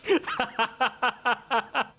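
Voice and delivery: high yelp into laughter; startled laughing. Real, not acted. Another fall, laughs.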